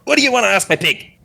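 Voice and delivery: high, fast halfling voice; touchy halfling. Acted. Halfling staring down the one questioning his pig, high and touchy. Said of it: "He's like".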